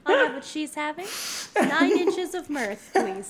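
mock restaurant order.

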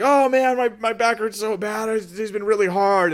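high, loud whining about a sore back; whiny complainer. Pretend. Friend complaining about his back, high whiny moan. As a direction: moan like someone fishing for sympathy. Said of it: "They're like".

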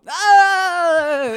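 high, loud mock dolphin squeak; imitating a dolphin's shriek; dolphin squeal. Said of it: "he was literally sounding like a dolphin".

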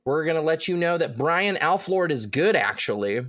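big drawn-out announcer read; hammed-up hype voice for the credits; announcer shout-outs. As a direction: present each name like a ring announcer. Reads out patron shout-outs.

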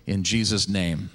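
solemn prayer voice, falling low; closing a prayer to the congregation, hushed and reverent. Acted. Closing prayer before sermon.

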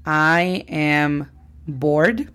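"I am bored" drawn out clearly; slow teacher quiz; slow model sentence. Shown as a demonstration. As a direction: say "I am bored" very slowly. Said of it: "¿es correcta la frase".

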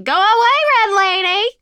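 high, loud shout at the ghost; mother's shout. Retold or imitated. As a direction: yell it to chase the ghost off.

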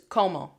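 "como" with aspirated K and gliding "oh" vowels; English-accented wrong. Shown as a demonstration. Teacher shows the anglicized mistake to avoid. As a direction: say "como" like an English speaker, kʰoʊmoʊ. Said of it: "You hear the difference?".

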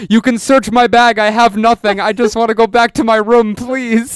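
high, loud mock begging; hammed-up desperate plea to a cop; pathetic pleading. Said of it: "So pathetic".

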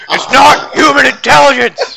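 frantic shouted conspiracy rant; Alex Jones shout. Retold or imitated. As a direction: yell it like a raving radio host. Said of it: "I can't do the Alex Jones".

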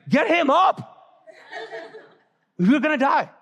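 high mock-panicked cry; freaking out. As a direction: imitate frantic disciples crying out for help. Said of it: "they're freaking out".

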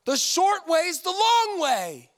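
firm, slow, loud trainer voice; stern coach shout. Retold or imitated. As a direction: bark it like a tough coach. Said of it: "A trainer will go".

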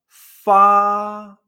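"fa" long, high, level and loud; first tone. Shown as a demonstration. Teacher shows any syllable keeps the tone, "fa" high and flat. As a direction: hold "fa" steady on a high note. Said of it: "tener ese mismo tono".

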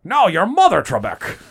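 high, loud mock taunt; Sean Connery. Contestant throwing insult at the quiz host, loud taunt. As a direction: fire it back as a hammed-up Connery. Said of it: "best Sean Connery impersonation".

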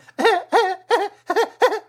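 high staccato imitated cackle; weird laugh. Imitating a film character's laugh, weird and high. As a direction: mimic her weird, high-pitched laugh. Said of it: "whatever weird laugh she was doing".

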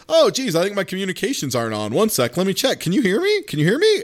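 put-on high, fast little girl checking her mic; playful high kid voice, rising on each question; kid on the radio. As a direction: pitch up like a child asking can you hear me. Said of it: "she starts being like".

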